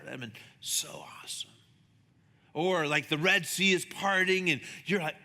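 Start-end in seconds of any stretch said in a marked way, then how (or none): 0.1-1.5 hushed, breathy-free whisper of wonder; whispered aside to the crowd about walking on water; awed whisper. Real, not acted. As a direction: drop to a whisper as if awestruck. Awed aside after Peter walking.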